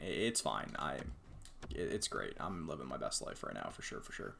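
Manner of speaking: low, soft sarcastic mutter; deadpan sarcasm. Real, not acted. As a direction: mutter it, clearly defeated.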